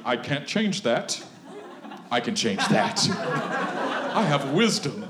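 lightly voiced smug wise man, laughing; pompous self-satisfaction, half joking; mock wise man. Preacher mocking a man who thinks he is wise, mock smug. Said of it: "This is a man of wisdom".

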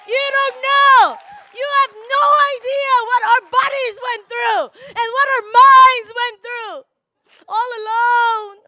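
preaching to the congregation, shouting; impassioned shout. Real, not acted. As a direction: preach it at full force. Preaching climax to mothers.

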